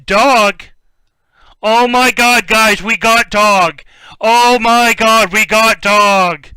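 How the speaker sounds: loud, high, slow excited cry; thrilled shout. Real, not acted. Pulling a rare card for the viewers, thrilled loud cry. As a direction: yell it out like you hit the jackpot.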